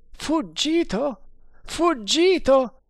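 high, drawn-out howl of dismay; terrible cry. A narrator's reading. Guard finds the prisoner's companion gone, crying out. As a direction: cry out, stretching each word. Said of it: "gridò".

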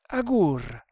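a brief startled cry, falling at the end; startled exclamation. A narrator's reading. Two men spot their companion running in, startled exclamation. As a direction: exclaim the name in mild alarm. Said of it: "esclamarono a una voce Tremal Naik e il Maharatto".

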